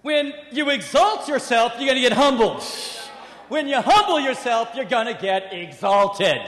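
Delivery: loud, emphatic sermon climax; preaching at full force. Acted. Preacher proclaiming the lesson to the congregation, loud. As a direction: drive the lesson home loudly.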